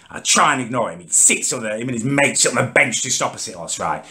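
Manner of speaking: ordinary talk, nothing marked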